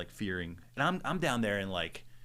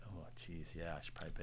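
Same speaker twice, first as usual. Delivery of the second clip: quiet realization; 6 semitones lower, 15 dB quieter than usual. Retold or imitated. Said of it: "you're like".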